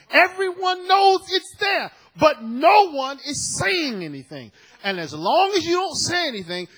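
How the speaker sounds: full-force preaching. Acted. Preacher pressing the church about silence, shouting sermon. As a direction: drive it home to the congregation.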